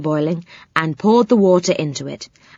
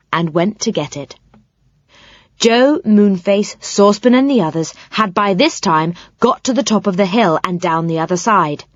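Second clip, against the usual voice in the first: ordinary delivery, nothing marked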